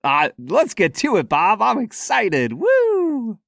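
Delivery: high, rising hype into a whoop; excited cheer. Real, not acted. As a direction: cheer like you can't wait. Hyped cheer before whiskey.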